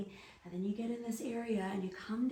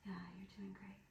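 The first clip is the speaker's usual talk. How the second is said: whispered praise; 10 dB quieter, faster than usual. Real, not acted. Whispering encouragement to partner.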